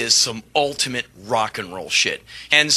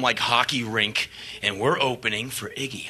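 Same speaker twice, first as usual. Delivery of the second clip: ordinary delivery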